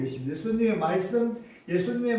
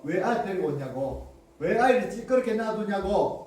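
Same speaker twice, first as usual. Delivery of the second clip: usual speaking voice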